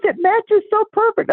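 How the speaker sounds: very high, slow delighted gushing. Retold or imitated. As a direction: savor each word in delight. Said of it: "she goes".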